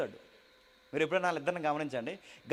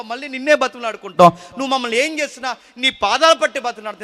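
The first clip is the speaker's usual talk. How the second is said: loud, impassioned pulpit delivery; preaching at full force; 6 semitones higher, 12 dB louder than usual. Acted. Preacher pleading to God for the congregation, loud and fervent. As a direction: preach it out to the whole hall.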